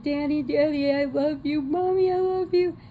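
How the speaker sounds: put-on high, frantic little-girl cry; mock panicked plea. Daughter begging over her tied-up parents, high and frantic. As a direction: squeal it like a terrified girl.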